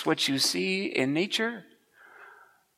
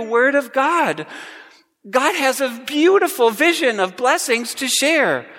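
higher and louder, bold sermon voice; full-force preaching; 5 semitones higher, 3 dB louder than usual. Acted. Pastor rousing the congregation to speak boldly, full-force preaching. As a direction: build to a ringing call of blessing.

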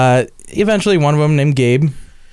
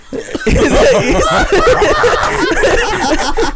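long high laughter. Real, not acted. Storyteller cracks up mid-story.

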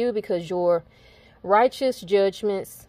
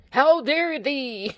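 high, slow, hammed-up scandalized cry; playful mock-biblical indignation, laughing after; mock archaic outrage; 7 semitones higher, 3 dB louder, much slower than usual. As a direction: drag it out high like a shocked old-fashioned preacher.

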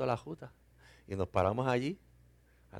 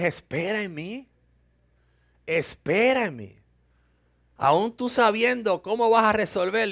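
preaching; 6 semitones higher, 6 dB louder than usual. Acted. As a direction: drive the lesson home from the pulpit. Preaching the lesson of the story.

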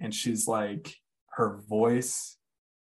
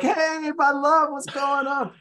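much higher, dreamy sing-song greeting; drugged-up wife; 5 dB louder, slower than usual. Retold or imitated. Husband imitating his drugged wife coming out of the ambulance, high and dreamy. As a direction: float it out, high and blissed on painkillers. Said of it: "they gave her fentanyl".

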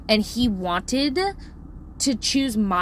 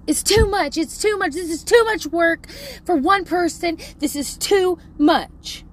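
imitating an overwhelmed kid, higher pitch; emotional meltdown; 6 semitones higher, 3 dB louder than usual. Parent imitating son over homework, melting down. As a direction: wail in mock despair. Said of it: "melting down emotionally".

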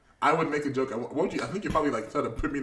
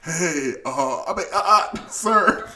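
higher, louder, hesitant "sir"; mock timid address; 5 semitones higher, 7 dB louder, much slower than usual. Friend mocking a nervous call to someone, high and halting. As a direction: play a flustered underling. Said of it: "Could you be like".

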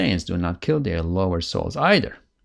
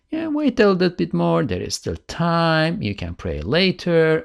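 a higher, cajoling voice of temptation, lightly voiced; a hint of a tempting inner voice; coaxing lower self; 7 semitones higher than usual. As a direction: sweet-talk him into delaying the prayer. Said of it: "your nafs is pulling you ... and says".

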